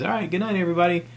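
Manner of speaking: high, breezy comic's sign-off; Ray signing off. Retold or imitated. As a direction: toss off a cheery goodnight to the crowd. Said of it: "and he goes".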